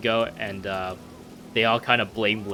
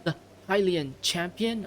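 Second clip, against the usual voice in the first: put-on higher-pitched amateur British accent; astonished British prince, pitched up; 9 semitones higher than usual. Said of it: "I did an accent for him".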